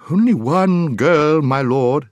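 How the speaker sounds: high, slow, hooted correction; loud owl call. A narrator's reading. Owl correcting the deaf dwarf, loudly. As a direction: call it slowly for poor ears.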